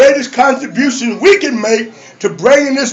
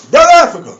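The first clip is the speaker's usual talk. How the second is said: high, punched greeting; hailing call; 4 semitones higher, faster than usual. Retold or imitated. I call everybody in there, high hailing call. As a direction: call out to each brother in turn. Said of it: "I call everybody in there".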